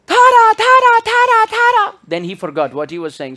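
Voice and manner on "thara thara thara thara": very high, loud parrot squawk. Retold or imitated. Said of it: "So he was saying".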